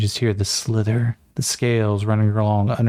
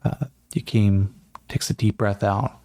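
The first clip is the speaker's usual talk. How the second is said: ordinary talk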